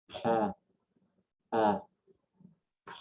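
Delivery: "Prends" and "en" with nasal vowel, slow and separate; nasal drill. Shown as a demonstration. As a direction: say "Prends" pushing air through the nose. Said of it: "nasal sounds".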